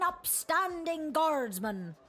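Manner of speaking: slow pirate drawl. Acted. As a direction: roll it out like a sea captain. Pirate says it first.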